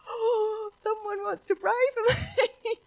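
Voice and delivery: high, slow, choked-up voice; tearful joy. Retold or imitated. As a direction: let it break with emotion. Driver moved, retold.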